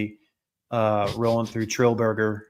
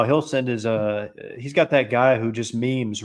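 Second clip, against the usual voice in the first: ordinary delivery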